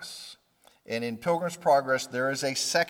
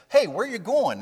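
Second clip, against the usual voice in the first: higher, louder call to a passer-by; friendly hail; 9 semitones higher, 6 dB louder than usual. Retold or imitated. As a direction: hail someone on the road with curiosity.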